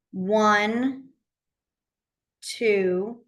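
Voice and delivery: slow, spaced-out counting; teacher reviewing numbers one by one; slow review drill. Shown as a demonstration. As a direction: count them off slowly for review. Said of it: "So we had the sign for number".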